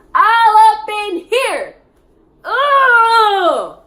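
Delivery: loud, high, dragged-out disgust; disgusted outburst. Retold or imitated. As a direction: howl it out in revulsion. Disgust at being spat on.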